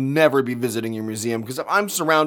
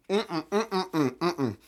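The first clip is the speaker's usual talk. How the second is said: closed-mouth refusal hums; 6 dB quieter, slower than usual. Real, not acted. Refusing the taste.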